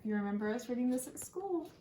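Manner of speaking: ordinary talk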